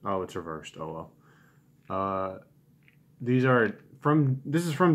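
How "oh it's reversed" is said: normal talking voice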